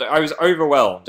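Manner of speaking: ordinary delivery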